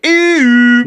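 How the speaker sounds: high, loud "ooh" whoop; Roomba chime. Retold or imitated. Host imitating the Roomba's noise at night, loud high chime. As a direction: imitate a machine's rising alert tone.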